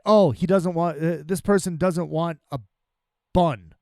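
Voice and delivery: ordinary talk, nothing marked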